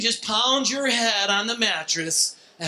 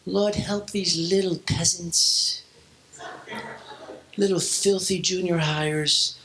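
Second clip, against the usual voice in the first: mock prayer; 4 semitones lower, slower than usual. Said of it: "I was praying for them".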